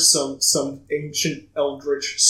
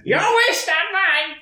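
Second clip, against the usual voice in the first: high mocking voice; 3 dB louder, slower than usual. GM answers as wish-granter.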